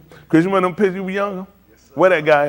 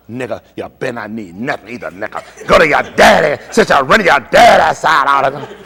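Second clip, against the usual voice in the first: imitating his wife; 4 dB louder than usual. Husband mocks wife threatening daughter, mock shouting. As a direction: ham up the wife turning on the kids. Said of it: "she'll tell her".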